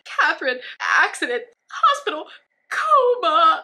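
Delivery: high, loud broken wails; mock sobbing. [name] crying bad news over the phone, loud high wail. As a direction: wail each word out.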